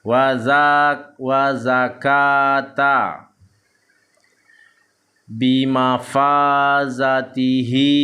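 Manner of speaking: slow, drawn-out Arabic syllables on an even tone; measured Quran-reading demonstration; Iqra recitation drill. Shown as a demonstration. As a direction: chant each syllable long and steady. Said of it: "kita akan lanjutkan membaca".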